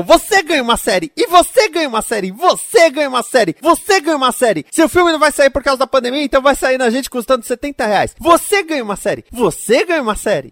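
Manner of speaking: high, fast, generous executive voice; boss handing out shows. Retold or imitated.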